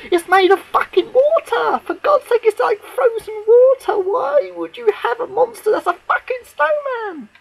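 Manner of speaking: loud, high, indignant ranting; high angry rant. Real, not acted. Fan ranting at the writer over snowmen, high indignant rant. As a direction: rant like the show insulted you.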